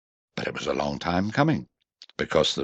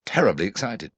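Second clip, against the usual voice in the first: ordinary delivery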